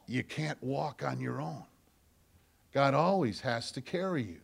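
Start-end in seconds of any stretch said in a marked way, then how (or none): none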